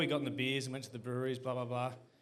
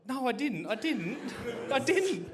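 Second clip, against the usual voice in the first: indignant denial; 7 semitones higher, 4 dB louder, slower than usual. Retold or imitated. Mate denying to the cop.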